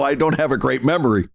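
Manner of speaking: ordinary delivery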